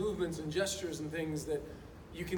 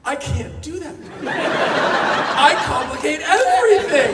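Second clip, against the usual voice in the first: louder, slow quote broken up by laughter; laughing imitation; 9 semitones higher, 15 dB louder, slower than usual. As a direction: laugh through a mock plea. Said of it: "And he goes".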